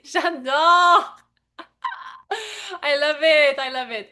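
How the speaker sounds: real laughing, excited outburst; delighted exclamation. Real, not acted. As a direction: burst out with delight. Delighted at participant's answer.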